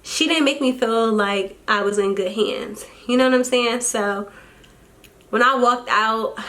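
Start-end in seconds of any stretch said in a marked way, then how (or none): none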